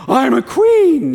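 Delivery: slow, loud, drawn-out royal announcement; princess realizing. Retold or imitated. Movie princess finally claims her crown, slow and loud. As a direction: savour the big reveal like a movie climax. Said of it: "she goes".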